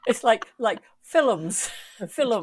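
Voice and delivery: "films" said as fil-ums, two syllables; Scottish "Films" with a vowel before the m; fil-um, the Scots way. Shown as a demonstration. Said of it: "Scots extra vowel in films".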